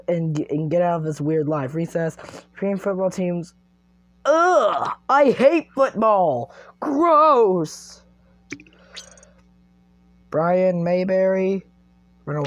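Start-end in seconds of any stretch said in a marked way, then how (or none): 4.2-8.0 loud, higher ugh of disgust; hammed-up loud revulsion; disgusted outburst. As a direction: spit out the hate for football. Hates football option.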